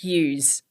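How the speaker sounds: "yous" with a z sound on the end; Queensland yous. Shown as a demonstration. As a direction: say "yous" like "use", one drawn-out syllable. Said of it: "they've got this particular word".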